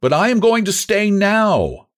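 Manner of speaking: high, eager reply; breathless. A narrator's reading. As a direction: blurt it out, eager to stay. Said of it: "he answered breathlessly".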